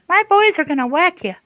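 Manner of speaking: high, loud mock mobster threat; rough, tough voice. Teasing Shaylee as mafia head, high mock-tough voice. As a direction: imitate her as a squeaky tough boss. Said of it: "In her rough and tough voice".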